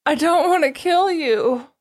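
high-pitched whiny quote; mock whining. Co-host chiming in as Kiba pleading with his rival, whining. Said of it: "like, whining too".

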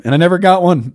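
high-pitched comic indignation; mock grievance. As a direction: protest like a still-wounded kid, hammed-up. Mock grievance about bike horn.

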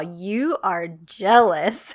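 slow, low, deliberate delivery, imitated; retold teasing accusation. Man needling the jealous woman, slow and low.

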